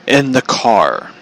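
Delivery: ordinary talk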